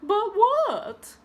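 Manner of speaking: high, loud prim demand; what is it, girl; impatient teacher. Acted. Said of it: "said Princess Viola".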